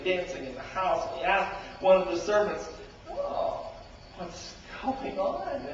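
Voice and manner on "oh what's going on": high, slow wondering question; puzzled. Acted. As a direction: draw it out, curious.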